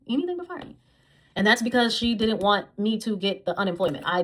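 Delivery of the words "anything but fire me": repeats it much higher and sarcastic; mocking high echo. Vlogger mocking her boss's excuse, high mocking echo.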